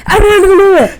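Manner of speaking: loud, high mock babbling; overexcited garbled babble. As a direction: imitate a friend blurting out gibberish. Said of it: "he'd be like".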